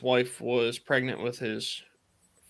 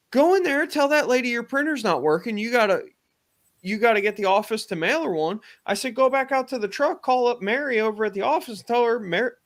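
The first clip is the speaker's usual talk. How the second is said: high-pitched, insistent directions, retold; emphatic step-by-step orders, acted out; urgent instructing; 9 semitones higher than usual. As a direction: push a dithering friend into action.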